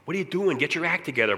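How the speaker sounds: firm, exasperated parent; scolding father. Acted. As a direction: play a dad taking his son to task. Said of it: "father was supposed to say that".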